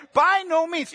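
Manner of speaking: high, insistent rejection; forceful, emphatic refusal. Acted. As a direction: throw out Paul's refusal firmly. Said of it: "Paul responds so forcefully and so emphatically".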